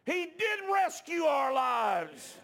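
high, slow shouted declaration; loud pulpit shout, words stretched out; full-force preaching. Real, not acted. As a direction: cry out the truth to the congregation.

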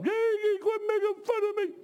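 very high strained protest; high mock-complaining voice; mocking sister impression. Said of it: "I'll make fun of".

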